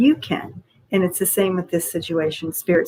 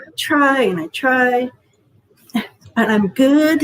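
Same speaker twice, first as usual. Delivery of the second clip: higher, slow, strained frustrated-cry quote; 6 semitones higher, slower than usual. Retold or imitated. Healer mimics Jen venting through frustrated tears. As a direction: imitate a tearful, angry "I try". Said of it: "it's a frustrating cry".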